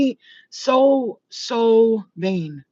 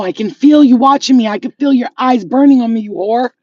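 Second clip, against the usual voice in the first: channeling a rival; 7 dB louder than usual. Retold or imitated. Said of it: "That's how they talk".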